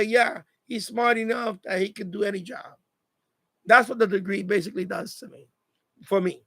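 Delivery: normal talking voice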